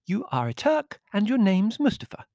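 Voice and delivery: high, loud indignant countess; sharp retort. A narrator's reading. Countess contradicting her servant, heated retort. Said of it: "she retorted".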